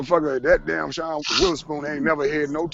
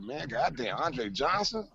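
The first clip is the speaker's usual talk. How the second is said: retold excitement; 7 semitones higher, slower than usual. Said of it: "I got so goddamn excited".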